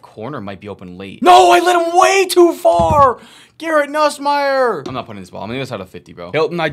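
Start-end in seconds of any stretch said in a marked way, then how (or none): 1.2-4.9 much higher, much louder, slow shout; real excited shouting; excited yell. Real, not acted. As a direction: bellow it out with the play. Throwing deep, excited outcome.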